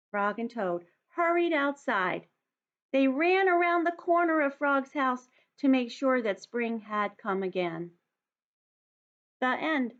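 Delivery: put-on lively read-aloud for young children; story narration. A narrator's reading. Teacher reads picture book aloud.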